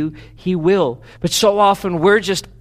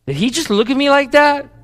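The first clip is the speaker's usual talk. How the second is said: suspicious quote; 8 semitones higher, 3 dB louder than usual. Retold or imitated. Spouse suspects a look.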